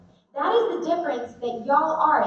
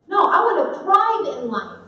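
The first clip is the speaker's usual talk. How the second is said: loud and raised, defiant; emphatic thrive; 6 semitones higher, 8 dB louder than usual. Real, not acted. Telling the audience she chose to thrive, loud. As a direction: declare it like a vow. Rejecting just functioning.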